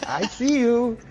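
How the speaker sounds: punchy reveal. Shown as a demonstration. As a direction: sell the punchline with pizzazz. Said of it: "You gotta, you gotta".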